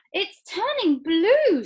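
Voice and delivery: very high, slow, loud scream. A narrator's reading. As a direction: scream in horror. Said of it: "screamed Missus Beauregard".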